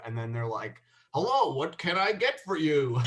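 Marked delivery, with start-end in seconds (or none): 1.1-3.0 higher-pitched drive-through Canadian; mock Canadian accent. Drive-through voice greeting a customer, mock Canadian accent. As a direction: imitate a stilted Canadian order-taker. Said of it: "had to do a Canadian accent".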